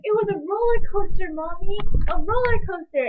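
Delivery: put-on high, loud and breathless, like a thrilled child; a mom imitating her son's wide-eyed excitement; excited little boy. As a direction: blurt it out as a kid fresh off the ride. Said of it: "he's like".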